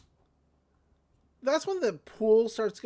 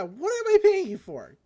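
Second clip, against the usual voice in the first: high, louder exasperated shout; hammed-up irate boss; mock boss yell; 11 semitones higher, 8 dB louder than usual. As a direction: demand why you pay them. Boss yelling at employees.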